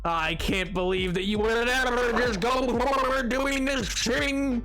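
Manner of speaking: mock furious rant. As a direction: rant as if badly wronged, playing it up. Mock threatening rant at someone.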